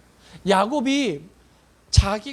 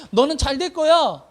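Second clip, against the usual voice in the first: firm, louder declaration with conviction; believing blessing; 4 semitones higher, 6 dB louder than usual. Acted. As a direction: bless a child as if certain, higher and louder. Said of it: "정말 이 아이가 잘 될 거라는 믿음이 있을 때". (확신에 찬 힘 있는 축복)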